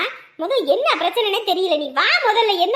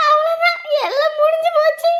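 wailing despair; 8 semitones higher than usual. Acted. Lamenting the lost money.

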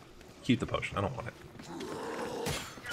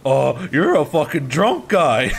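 drawn-out, louder shouted guess; retold shout; 3 semitones higher, 6 dB louder, slower than usual. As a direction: shout it out slowly like a kid who guessed it. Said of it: "I shout out".

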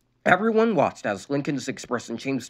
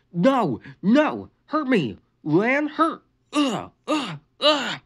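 angry yell; 11 semitones higher, much slower than usual. A narrator's reading. Injured boy yelling at his sister, angry. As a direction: yell broken words in rage. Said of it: "Lincoln yelled".

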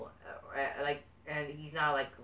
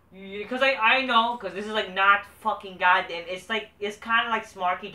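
heated outburst; 6 semitones higher, 10 dB louder than usual. Real, not acted. Heated defense of his review.

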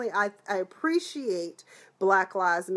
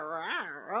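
low, soft playful rawr; playful growl; 6 semitones lower, 9 dB quieter than usual. Pretend. Teasing Aunt Vi as a cougar with a low playful growl.

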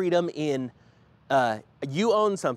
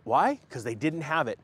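usual speaking voice

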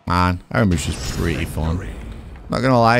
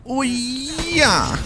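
excited shout; much slower than usual. Real, not acted. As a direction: cheer it out, way up high. Celebrating a hit in game.

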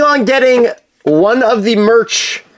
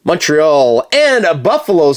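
ordinary delivery

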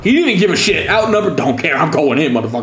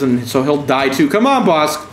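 usual speaking voice

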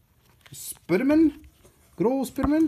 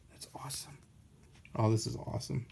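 hushed whisper; 3 semitones lower, 10 dB quieter, faster than usual. Real, not acted. Reacting to a spiked-floor panel, whispered. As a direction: breathe it out in awe. Whispered aside over comic.

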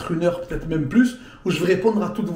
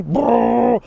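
loud rolled-lip engine roar; bruit de Lamborghini imité; 4 semitones higher, 4 dB louder than usual. Retold or imitated. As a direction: imitate a revving supercar. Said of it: "elle faisait le bruit d'une Lamborghini". (imitation de moteur rugissant)

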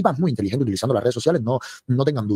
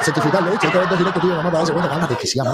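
teasing laughter; 6 semitones higher than usual. Real, not acted.

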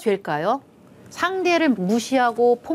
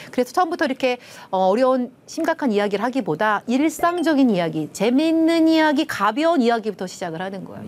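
normal talking voice

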